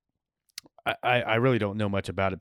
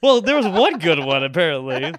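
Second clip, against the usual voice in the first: teasing; 6 semitones higher, 9 dB louder than usual. Real, not acted. Teasing after the joke.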